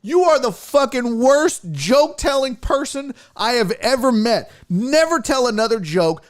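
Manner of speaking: high, loud furious tirade; angry rant. Retold or imitated. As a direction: tear into the heckler. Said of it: "I was like".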